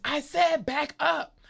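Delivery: high, slow mock yell; angry demand. Teller mimicking the driver ahead, slow high mock yell. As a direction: stretch it out high and indignant. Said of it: "And so she goes".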